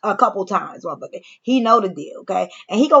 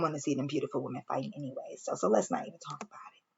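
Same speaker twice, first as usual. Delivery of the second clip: whisper; 4 semitones lower, 14 dB quieter, faster than usual. Real, not acted. Gossipy aside, dropping the subject.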